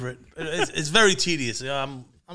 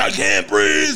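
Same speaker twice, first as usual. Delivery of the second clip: retold scream; 7 semitones lower, 7 dB louder, slower than usual.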